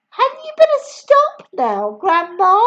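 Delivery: high, loud worried plea; boy calling up anxiously to Grandma. Acted. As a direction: beg her to stop. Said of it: "George said".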